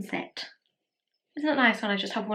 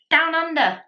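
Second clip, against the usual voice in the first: mock Australian accent; 7 semitones higher, 5 dB louder than usual. Shade name mimicked, then apologises repeatedly.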